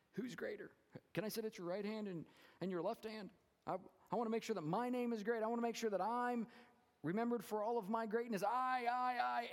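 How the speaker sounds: high, pushy, self-important voice; mock the disciples grabbing for glory; bragging disciples. Pastor mocking the disciples arguing over who is greatest, high and boastful.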